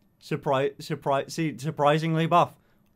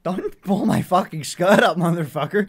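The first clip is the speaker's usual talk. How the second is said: mock angry; 5 semitones higher, 6 dB louder than usual. Friend tugging at the skirt.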